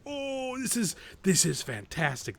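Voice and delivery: high drawn-out "oh", then relishing praise; delighted awe. Retold or imitated. Viewer relishing the awkward love story, delighted awe. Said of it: "And you're just like".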